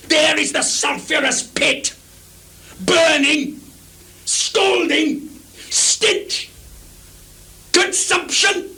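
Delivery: high shouted rant, spitting each word; mad tirade. Acted. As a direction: rage building to disgust. Lear's mad tirade on women.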